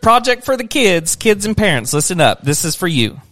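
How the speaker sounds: loud, high announcer call. Acted. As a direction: call it out like a host. Mock announcement to viewers.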